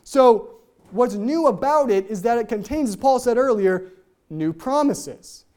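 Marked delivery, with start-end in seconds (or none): none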